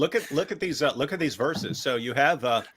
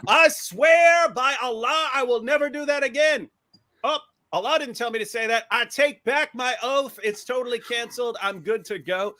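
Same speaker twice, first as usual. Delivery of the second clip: mock oath; 9 semitones higher than usual. Prophet swearing then cancelling his oath, high mock voice. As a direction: swear grandly, then flip it off.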